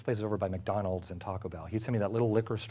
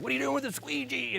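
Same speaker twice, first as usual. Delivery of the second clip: put-on much higher drunken demand; drunk editor yelling. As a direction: bark it like a wasted boss.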